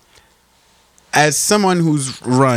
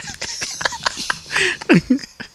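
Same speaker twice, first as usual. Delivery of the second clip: a long high laugh that fades down; a squeaky, fading giggle; 6 dB quieter than usual. Real, not acted. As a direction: burst out laughing high and let it fall. Laughing at Sayo joke.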